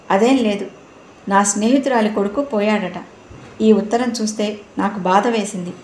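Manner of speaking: plain reading voice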